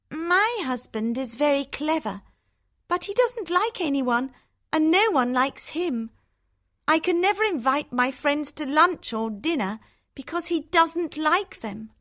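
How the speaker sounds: high-pitched grumbling about a husband; unhappy sister. A narrator's reading. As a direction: complain in a storybook sister's high voice. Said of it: "They are both very unhappy".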